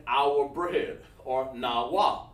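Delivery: "our bread or Nawa" spaced out word by word; slow and measured. Shown as a demonstration. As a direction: take your time on each word of "our bread or Nawa". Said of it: "don't wanna say Hebrew too fast".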